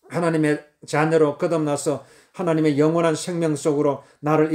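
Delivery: low, even prayer recitation. Acted. Models a prayer of thanks.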